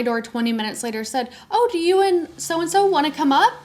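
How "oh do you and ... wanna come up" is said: imitating them, very high-pitched sweet act; mock-innocent high voice; feigned innocence. Neighbors pretending not to know he left, feigned innocence.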